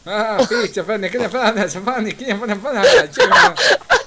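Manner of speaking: loud, high-pitched Doric gabble; hammed-up Doric babble breaking into laughter; mock Doric. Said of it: "they speak Doric".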